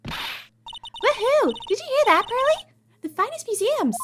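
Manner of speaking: high squealing cheer, way up; excited girl voice. Acted. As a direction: cheer like an overjoyed teen girl.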